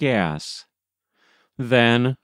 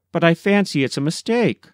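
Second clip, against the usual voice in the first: fast, higher, embarrassed rush; 6 semitones higher, much faster than usual. A narrator's reading. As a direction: hurry it out, flustered. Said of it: "in embarrassment".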